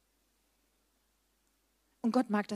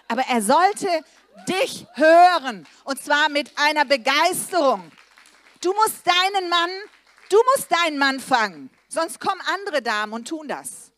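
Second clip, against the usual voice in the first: fervent preaching; 6 semitones higher, 11 dB louder than usual. Acted. Preacher urging wives to win their husbands, loud and fervent. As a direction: fire the women up from the pulpit.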